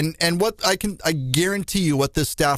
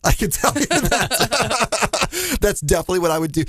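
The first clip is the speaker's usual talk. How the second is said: laughing; 7 semitones higher than usual. Real, not acted. Laughing while admitting.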